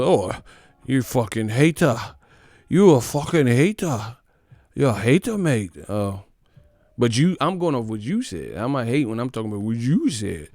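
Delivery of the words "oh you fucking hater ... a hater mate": a hint of mock British accent calling him hater; mock British fan. Mocking fans who call the vlogger a hater, light British accent. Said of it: "I talk about what's real".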